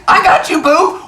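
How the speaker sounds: high, loud reassurance; mock-heroic rescuer. Rescuer answering the scared kid, high mock-heroic voice. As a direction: assure the kid at high pitch.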